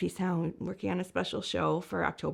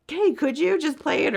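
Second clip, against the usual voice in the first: quoting artists; 11 semitones higher, 8 dB louder than usual. Retold or imitated. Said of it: "but just are like".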